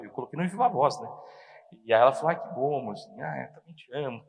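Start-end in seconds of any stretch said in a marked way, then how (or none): 2.3-4.2 very soft, low, loving wife's reply; tender. Retold or imitated. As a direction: return the affection gently. Voicing the wife's loving phone reply.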